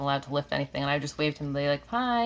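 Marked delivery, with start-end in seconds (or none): 1.9-2.2 bright high hello called through the window; cheery hi. Retold or imitated. Wife greeting her husband through the window, high cheery hi. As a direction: wave and call out a happy hi. Waving at husband shoveling snow.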